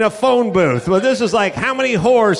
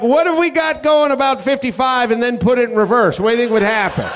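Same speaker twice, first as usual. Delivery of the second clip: reckless renter; 5 semitones higher, faster than usual. Retold or imitated. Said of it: "you're like".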